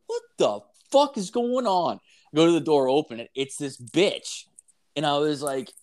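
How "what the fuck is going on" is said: high, incredulous mock outburst; retold alarm. Man at home hears banging, retold startled alarm. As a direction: act out being startled, voice jumping up.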